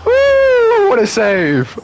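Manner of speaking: loud, very high whoop, then high exclamation; piercing woo easing into a high-pitched cheer; excited cheer. Real, not acted. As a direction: whoop loudly at a great save. Cheering a save in game.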